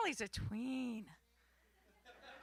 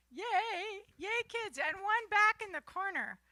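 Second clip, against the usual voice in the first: cheering yay; 7 semitones higher, 5 dB louder than usual. Real, not acted. Host cheering for the kids.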